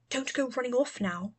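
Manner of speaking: ordinary delivery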